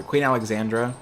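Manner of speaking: normal talking voice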